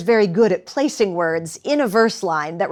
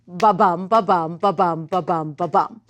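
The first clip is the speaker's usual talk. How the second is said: even "ba-bum" pulse, BUM stressed each time; steady heartbeat "ba-bum", landing on the second syllable; iambic rhythm. Shown as a demonstration. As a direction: chant "ba-bum" in fives, weak BA, strong BUM. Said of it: "That's iambic pentameter".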